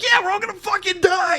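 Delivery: very high, loud doom-laden yell; mock hysterical alarm. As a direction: escalate the panic at full volume. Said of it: "just escalating the situation".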